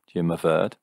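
ordinary delivery

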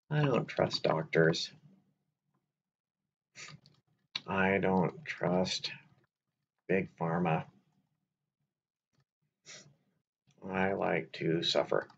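slow, dry mock complaint; sarcastic. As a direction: drag it out sarcastically. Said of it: "I'm being sarcastic here".

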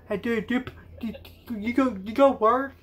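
usual speaking voice

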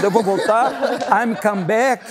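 high, slow, broken-English lament; he tells everyone in broken English, high and pleading; helpless traveller. Retold or imitated. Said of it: "Eu ficava assim pra todo mundo".

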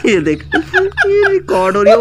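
breaking into laughter at the reveal; laughing reveal. Real, not acted. Revealing the prank to his wife, laughing. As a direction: laugh out the confession that it was filmed.

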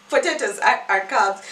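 loud protest through laughter; laughing protest. Retold or imitated. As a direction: insist while laughing. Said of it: "I was like".

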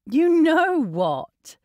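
high, slow, pointed rebuke; slow, emphatic, mock-offended; indignant retort. A narrator's reading. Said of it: "She glared at his laughing expression".

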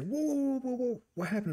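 high string of startled whoas, acted; imitated taken-aback reaction; alarmed friend. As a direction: cut in to calm a ranting pal.

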